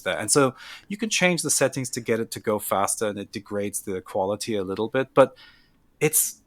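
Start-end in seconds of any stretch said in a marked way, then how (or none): none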